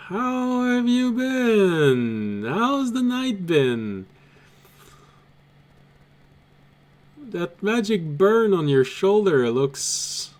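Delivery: high, slow mocking voice; taunting noble. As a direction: sneer down from the tower. Character taunting from the tower.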